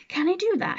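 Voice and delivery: eager, high question; a boy's eager question. A narrator's reading. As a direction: ask it eagerly. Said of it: "he asked eagerly".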